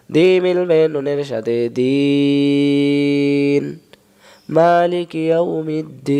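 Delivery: very slow, each syllable stretched; slow drawn-out recitation. Shown as a demonstration. Teacher reciting slowly to model the pull. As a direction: chant it slowly and draw out দিন. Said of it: "হুজুরেরা সুন্দর করে টান দেয় না".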